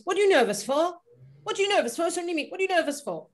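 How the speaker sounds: high, teasing reassurance. Retold or imitated. Reassuring the nervous singer, high and teasing. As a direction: brush off the nerves lightly. Impersonating [name] reassuring her.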